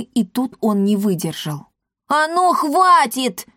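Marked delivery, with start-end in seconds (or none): 2.1-3.5 high, slow, forceful bark; barked command. A narrator's reading. Pygmalion ends the fight, barking angrily. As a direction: put a stop to it, losing patience. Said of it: "рявкнул Пигмалион".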